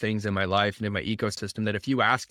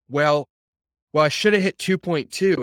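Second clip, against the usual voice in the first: higher, louder stammering excuse; mock flustered excuse; 5 semitones higher, 5 dB louder than usual. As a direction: play the caught-out person fumbling for an answer. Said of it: "And they're like".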